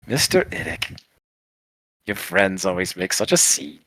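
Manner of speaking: quiet little gnome voice; gnome character. Acted. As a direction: murmur it in passing, softly and wryly. Passing gnome addresses a player.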